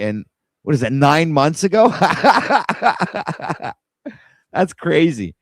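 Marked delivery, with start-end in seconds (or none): 1.9-3.7 long, high-pitched laugh; real laughing fit, higher than speech; amused laugh. Real, not acted. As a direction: burst into a run of high laughter. Amused by old recommendation.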